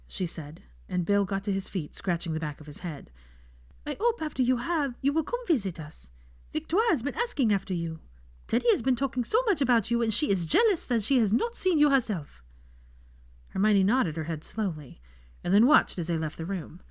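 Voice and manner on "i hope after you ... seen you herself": Fleur. A narrator's reading. Fleur asks Draco for time with Hermione, polite and higher.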